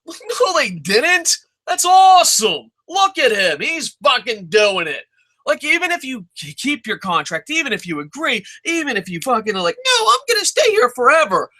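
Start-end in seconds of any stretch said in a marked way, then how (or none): 0.1-5.0 high-pitched, delighted disbelief; giddy imitation of amazed onlookers; acted gleeful astonishment. Thrilled coworkers watching someone quit. 9.8-11.5 very high, silly mocking voice; exaggerated falsetto parody; mock loyal employee.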